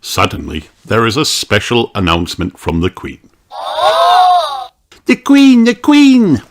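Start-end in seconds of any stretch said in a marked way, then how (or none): 3.5-6.4 high, loud excited shout; cheering. A narrator's reading. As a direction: cheer it out thrilled. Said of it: "Peppa cheers".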